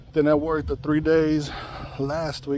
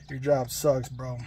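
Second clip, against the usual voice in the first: ordinary talk, nothing marked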